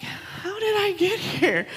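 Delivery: a lightly voiced mutter to herself, slow; mock muttering. Mother muttering to herself over the kids' snacks, a slow mock mutter. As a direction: mutter it slowly under your breath, half-joking. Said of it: "mumbling to myself, muttering to myself".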